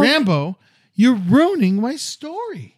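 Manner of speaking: high, loud exasperated call; scolding the dog. Real, not acted. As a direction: snap at the dog to cut it out. Scolding the dog mid-story.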